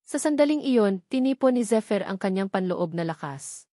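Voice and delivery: usual speaking voice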